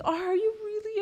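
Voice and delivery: high-pitched, mock-tearful disbelief; put-on weepy high voice; imitated tearful bawling. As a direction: imitate a child crying happily, voice way up. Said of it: "She was in the car bawling".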